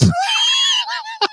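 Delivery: high laugh. Real, not acted. Laughs at slap story.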